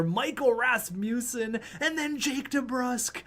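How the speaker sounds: high-pitched excited name call; excited goal call. Real, not acted. Gamer calling his team's two quick goals, excited. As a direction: call out the scorers with rising excitement.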